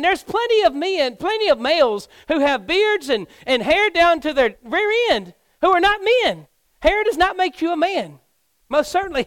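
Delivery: loud, high-pitched sermon delivery; forceful preaching. Real, not acted. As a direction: drive the point home like a fired-up preacher.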